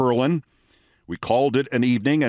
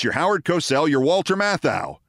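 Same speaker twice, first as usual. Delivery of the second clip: usual speaking voice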